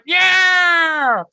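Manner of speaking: high, loud, stretched cheer; loud joyful yell; excited shout. Retold or imitated. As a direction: whoop it like a kid getting a high five. Kid high-fiving.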